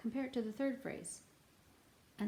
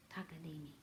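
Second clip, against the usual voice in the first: quiet to oneself; 6 semitones lower, 13 dB quieter, faster than usual. Retold or imitated. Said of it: "you go".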